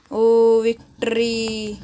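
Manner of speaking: loud, slow victory cheer; excited victory cry. Real, not acted. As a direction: savour the win out loud. Winning the match. (जीत की खुशी में ज़ोरदार आवाज़)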